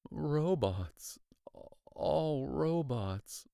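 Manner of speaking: soft, slow murmur. Acted. Shaken boy murmuring as the music stops. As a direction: say it under your breath in shock. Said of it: "I murmured".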